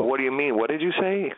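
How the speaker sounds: high pitched disbelief; what did you say, shocked and rising; incredulous. Real, not acted. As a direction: friend can't believe what he heard, asks high. Incredulous question at friend.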